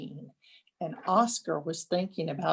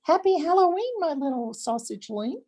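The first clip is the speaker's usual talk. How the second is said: much higher, sing-song mother voice; a proud mother greeting her son; doting mother; 9 semitones higher, faster than usual. A narrator's reading. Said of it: "said Oscar's mother".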